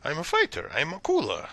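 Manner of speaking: loud first objection, swinging pitch; indignant fighter Akula. Acted. Fighter objects to being called barbarian, indignant character voice. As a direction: butt in and insist on who you are.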